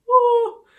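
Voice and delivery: a high, loud "woo" of mock shock. Teacher whoops to the kids after Nabal refuses.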